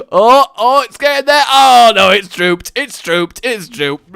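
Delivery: loud, high rising cries settling down; exaggerated gamer panic, fading; mock alarm. Real, not acted. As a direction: react in dismay as it collapses. Gamer reacting to floppy beam.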